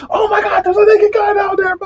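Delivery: high, alarmed yell; hammed-up shriek of alarm; shocked mock shout. Workers running over to report what they saw, shocked shout.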